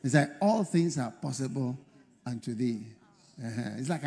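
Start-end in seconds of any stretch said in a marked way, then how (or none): 0.4-2.8 low, softening, deferential address to God; polite prayer. Retold or imitated. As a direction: pray it courteously, as Jesus did. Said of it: "Jesus' own was very polite".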